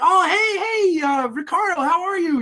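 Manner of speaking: high-pitched imitation of an effusive acquaintance; put-on high voice, greeting an old friend; gushing greeting. Voicing woman greeting an acquaintance.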